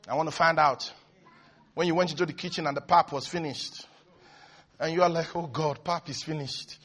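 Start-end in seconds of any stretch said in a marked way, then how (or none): 5.4-6.8 low, hushed murmur of disappointment; quiet dismay. Acted. Finding the pap finished in the kitchen, quiet dismay. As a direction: mutter it low, under your breath. Acted dismay at empty pot.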